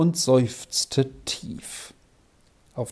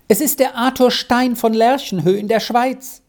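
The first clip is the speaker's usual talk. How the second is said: put-on high, eager child's voice; child Squirrel, earnest; 9 semitones higher than usual. A narrator's reading. Squirrel explains herself to the professor, earnest child. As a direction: answer back earnestly, pitched up like a child.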